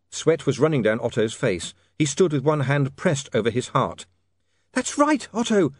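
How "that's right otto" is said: very high woman's cheer; shouted encouragement. A narrator's reading. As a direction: call it out high to urge him on. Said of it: "shouted Sacharissa".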